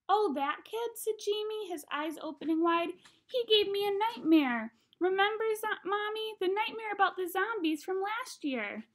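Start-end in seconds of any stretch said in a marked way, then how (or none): 0.1-0.9 put-on high, louder kid voice; wide-eyed little boy's voice; little kid brother. A narrator's reading. 3.3-8.9 put-on high, loud little-boy voice; small child telling mom, high-pitched; little kid brother. A narrator's reading.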